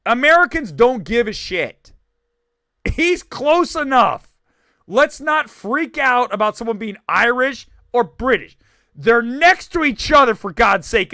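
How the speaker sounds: loud, exasperated ranting; comic rant. Real, not acted. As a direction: lay it down loudly, thoroughly fed up. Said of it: "There's [name] going on a rant".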